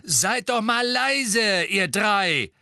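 high, loud, drawn-out scolding. A narrator's reading. Parent telling three singing children off, exasperated shout. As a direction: shout over the noisy children.

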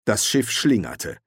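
usual speaking voice

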